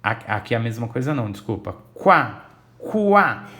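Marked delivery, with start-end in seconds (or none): 1.9-3.5 "quá" punched loud, the U stretched into KU-A; U pronunciado. Shown as a demonstration. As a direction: sound out "quá" loudly as KWA, then higher as KU-A. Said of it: "eu ouço o som do U". ("quá" forte, com o U bem audível)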